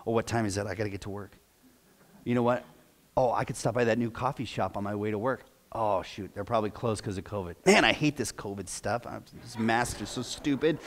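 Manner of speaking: soft, fast drifting thoughts; lightly acted distracted musing; distracted mind wandering. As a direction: let the mind wander off mid-prayer. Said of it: "their prayer goes something like this".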